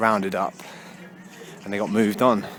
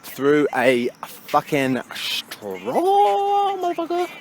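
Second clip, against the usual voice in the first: slow, stretched "through a fucking straw"; leaning hard on every word; drawn-out emphasis; slower than usual. Pretend. Hammering the point home to viewers. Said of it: "Can't even stress that enough".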